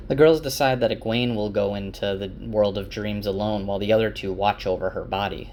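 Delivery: ordinary delivery, nothing marked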